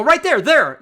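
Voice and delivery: sudden high, loud callout; retold cry of catching someone in the act; mock exclamation. Acting out catching her in it.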